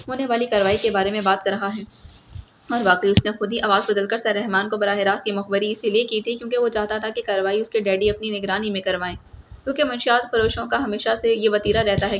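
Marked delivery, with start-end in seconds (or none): none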